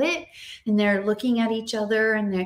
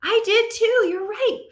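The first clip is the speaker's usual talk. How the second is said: cheerful praise; 11 semitones higher, 5 dB louder than usual. Shown as a demonstration. Teacher praises class.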